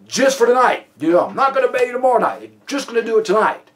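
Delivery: high, loud, insistent mock caveat; face-saving concession. Suspect saving face with officer, insistent caveat. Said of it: "a way to save face to essentially say".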